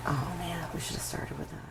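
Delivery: very soft, voiceless whisper; real hushed aside. Real, not acted. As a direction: whisper it under your breath. Muttered aside about a forgotten explanation.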